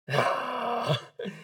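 long, audible mock sigh; acted weary exhale; exasperated sigh. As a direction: let out a heavy breath at the request. Reaction to pastors' invitation.

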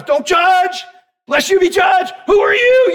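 high, loud mock scolding; hammed-up accuser voice; mocking the world. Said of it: "the world wants to throw us".

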